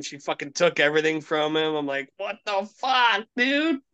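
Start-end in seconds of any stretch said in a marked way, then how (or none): 2.2-3.8 high, slow disbelieving what the fuck; stretched-out shocked dude; retelling a friend's mess in disbelief; incredulous. Retold or imitated. Shocked reaction to friend's story.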